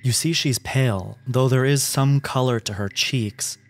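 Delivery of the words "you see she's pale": ordinary talk, nothing marked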